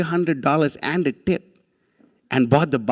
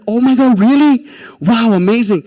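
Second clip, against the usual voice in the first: higher and louder, imitating his own delighted gush; mock gushing excitement; 6 semitones higher, 3 dB louder than usual. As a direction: replay the over-the-top wow for the customer. Retold gushing reply to customer.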